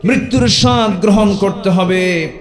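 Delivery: high, ringing sermon proclamation; preaching at full force. Acted. Preacher declaims the verse on death to the gathering. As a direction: lift the pitch high and declaim it to the crowd.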